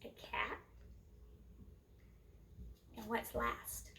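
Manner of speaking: ordinary delivery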